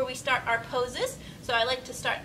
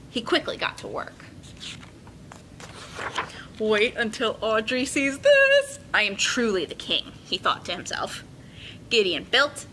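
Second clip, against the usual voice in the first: put-on bright, paced read-aloud for kids; story narration. A narrator's reading. Teacher reading a picture book to her class, lively and clear. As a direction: tell the story to a room of children.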